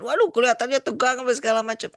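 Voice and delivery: high, loud and fast, hamming up the alarm; mock alarm. Webinar host imitating a viewer shocked by a presidential debate, mock alarmed and fast. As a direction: gasp out the worry like a shocked viewer.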